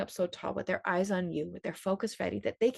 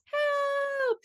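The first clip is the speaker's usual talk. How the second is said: very high, drawn-out call for help; shouted cry; 4 dB louder than usual. A narrator's reading. Storyteller as baby bee needing to be heard, high shout. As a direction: yell it with all her might. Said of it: "she shouted".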